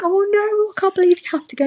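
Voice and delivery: high, fast dismayed wail. Retold or imitated. Mother to the departing nurse, high dismayed. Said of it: "I was like".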